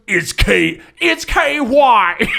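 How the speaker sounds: slow, shouted giant boast; deep-voiced Bane. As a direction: boom it out slowly. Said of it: "His voice gets deeper".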